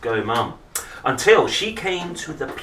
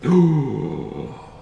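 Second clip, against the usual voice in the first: surprised gasp; 2 semitones higher, 4 dB louder than usual. Acted. Surprise at the picture.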